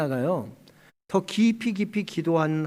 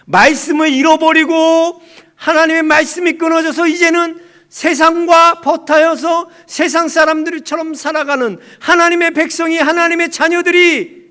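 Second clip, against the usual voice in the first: high, very loud sustained cry; full-force preaching; 11 semitones higher, 13 dB louder than usual. Real, not acted. Preacher decrying people cut off from the word, full-force cry. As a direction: proclaim it urgently, every phrase driven hard.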